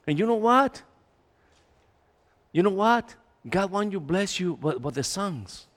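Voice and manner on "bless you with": ordinary talk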